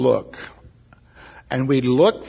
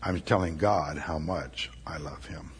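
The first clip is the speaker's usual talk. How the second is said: tender; 7 semitones lower, 8 dB quieter than usual. Real, not acted. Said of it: "tender aside to God".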